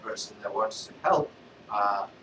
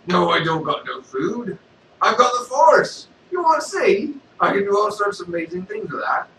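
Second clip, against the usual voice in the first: loud boastful Vader, higher; Darth Vader; 6 semitones higher, 9 dB louder than usual. Acted. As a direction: show off the Force, loudly.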